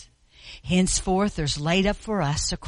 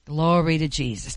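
ordinary delivery

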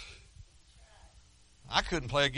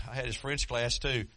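ordinary talk, nothing marked

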